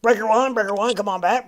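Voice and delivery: calling out over a CB radio, lightly voiced; CB radio trucker. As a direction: hail a trucker channel on CB. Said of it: "It sounds like an old C B radio".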